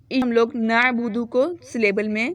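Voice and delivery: ordinary delivery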